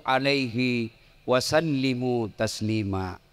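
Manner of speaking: slow, low, measured Arabic recitation; Quran recitation. Real, not acted. Preacher recites a verse to the congregation, slow solemn recitation. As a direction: recite the verse slowly with drawn-out vowels.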